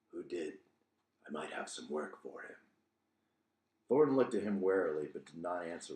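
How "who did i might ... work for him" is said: low hooded stranger. Acted.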